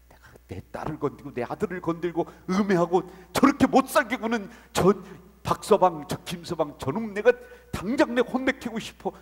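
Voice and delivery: loud, rising into indignant shouting; name the tormentors with rage; angry outburst. Acted. Preacher voicing God furious at those who harm His children, loud angry outburst.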